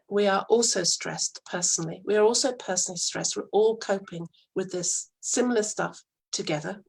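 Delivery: ordinary talk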